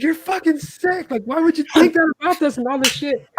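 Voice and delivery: high-pitched, slowed, outraged yelling; angry outburst. Retold or imitated. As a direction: retell the fury, voice climbing high. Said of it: "I got mad".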